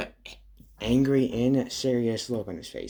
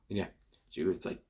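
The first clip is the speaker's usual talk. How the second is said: ordinary delivery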